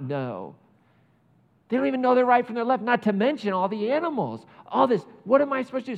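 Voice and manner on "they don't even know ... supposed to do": higher, louder, fast plea; impassioned God. Retold or imitated. God defending Nineveh to Jonah, higher and louder. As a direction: rush it out in exasperated compassion.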